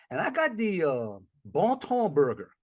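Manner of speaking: usual speaking voice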